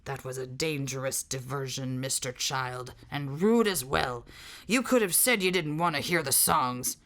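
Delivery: a low menacing hiss. A narrator's reading.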